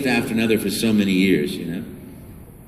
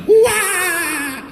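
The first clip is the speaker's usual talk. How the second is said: Mario yell. Shown as a demonstration. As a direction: belt the hero's cry. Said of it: "just remembering that".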